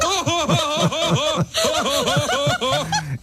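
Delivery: big mocking laugh; high burst of laughter. Real, not acted. Laughs at quote.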